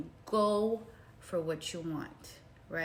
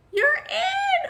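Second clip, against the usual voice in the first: much higher, loud joyful shout; excited shout; 14 dB louder than usual. Retold or imitated. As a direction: announce the big news with a burst. Friend announcing her acceptance.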